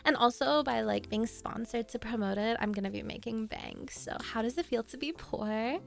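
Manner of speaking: low, soft influencer promo voice; bragging Instagram baddie, low-key and smug. Influencer showing off a PR package on stories, Instagram baddie voice. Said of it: "Instagram baddies, like, when they're promoting".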